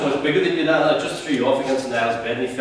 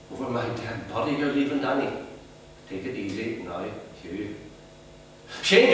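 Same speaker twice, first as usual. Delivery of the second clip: ordinary talk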